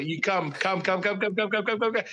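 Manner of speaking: rapid-fire repeated calls, pitch climbing; coaxing a dog. Retold or imitated. Owner calling his dog back to Papa, eager coaxing. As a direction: coax a dog with quickening little calls.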